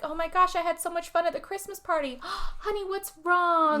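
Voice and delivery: gushing neighbor. Retold or imitated. Neighbor woman fussing over crying Sarah, high and gushing. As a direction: pitch it way up and fuss like a nosy neighbor. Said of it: "she starts off by like".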